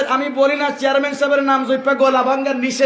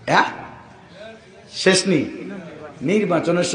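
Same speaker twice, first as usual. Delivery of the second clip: ordinary talk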